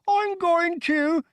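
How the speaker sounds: high, slow, cut-off muffled threat. A narrator's reading. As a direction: snarl through clamped hands. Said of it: "in a muffled voice".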